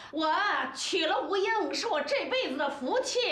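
high-pitched, heated reproach; indignant scolding. Acted. Friend throws the husband's old vow back at him, indignant scolding.

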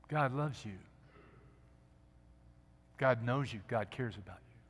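voicing God's gentle whisper, hushed. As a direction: speak it as a tender secret. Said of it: "The gentle whisper".

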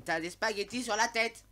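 real outburst. Real, not acted. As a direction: yell at the absurd game. S'énerve contre le jeu. (crie, exaspéré par l'absurdité)

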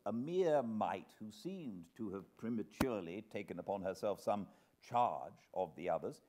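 ordinary delivery

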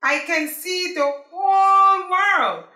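loud, slow triumphant call; shouting. Acted. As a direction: shout it out over the island. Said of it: "He shouted to himself".

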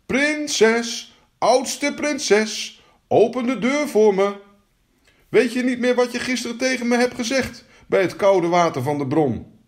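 high, insistent call through a door; high-pitched demanding frog. Acted. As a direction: call in a high, chanting frog voice, then press the point. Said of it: "en riep".

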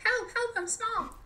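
squeaky high little cry for help; a tiny creature calling for help; tiny voice sound effect. Retold or imitated. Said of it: "my little".

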